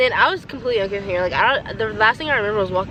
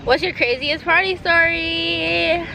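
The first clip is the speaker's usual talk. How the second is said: game show host; 8 semitones higher, slower than usual. Acted. Reading a question card aloud.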